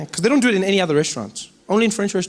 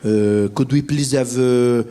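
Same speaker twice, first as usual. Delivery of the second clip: put-on low, halting French tourist accent; hesitant French diner ordering slowly; 8 semitones lower, 4 dB louder, slower than usual. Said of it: "French people sitting ... looking at the menu like".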